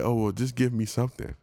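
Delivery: mild mock begging voice; lightly voiced hawker begging. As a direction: coax him for anything. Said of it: "they just like".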